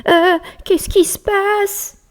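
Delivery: very high, slow drowsy squeak; sleepy mouse. Acted. Freshly woken mouse asking the bear, very high and slow. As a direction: mumble it half awake.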